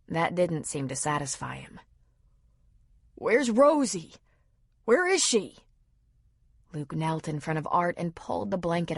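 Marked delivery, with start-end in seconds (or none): 3.1-5.6 panicked asking. A narrator's reading. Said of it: "clearly panicked".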